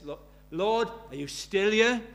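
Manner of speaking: loud, high, amazed call; excited wonder. Retold or imitated. Stephen Lungu waking after conversion, loud and amazed. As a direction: call out to the Lord in delight.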